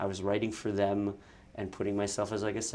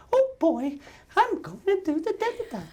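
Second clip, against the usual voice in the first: very high squeaky cartoon mouse, louder; chirpy falsetto cartoon mouse; Mickey Mouse voice; 6 dB louder than usual. Creator voicing his doll on camera, squeaky Mickey. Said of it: "me making the voice behind the camera".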